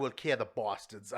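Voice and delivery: a bad British accent drifting off target; botched British accent. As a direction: try British and miss. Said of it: "It came out Australian".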